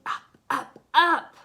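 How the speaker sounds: ordinary delivery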